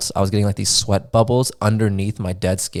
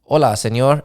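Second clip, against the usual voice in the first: raised-pitch Mexican accent imitation; Mexican staff accent; 4 semitones higher, 3 dB louder than usual. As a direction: greet like a Mexican hotel clerk. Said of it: "what is the accent".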